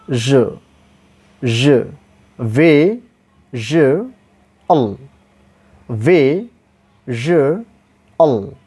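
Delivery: VE, JE, AL as separate sounds with pauses between; syllable by syllable. Shown as a demonstration. Teacher breaks the word down, syllable by syllable. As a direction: break "visual" into vi, zhu, ul, each on its own. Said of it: "after the ja sound".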